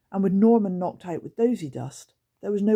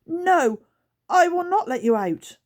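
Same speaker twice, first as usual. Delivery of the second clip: 1 nervous wobble; 13 semitones higher, 7 dB louder than usual. Acted. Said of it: "with a nervous wobble in her voice".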